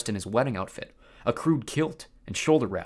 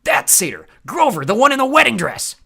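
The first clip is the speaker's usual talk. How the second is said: loud, high yell; furious shout; 11 semitones higher, 12 dB louder than usual. Acted. Clarice yelling Grover's identity, loud shout. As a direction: scream it out.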